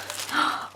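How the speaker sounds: soft gasp of surprise; quick, quiet intake of breath; surprised gasp. Real, not acted. Unboxer spotting something new to her, soft surprised gasp. Surprised by what she unpacks.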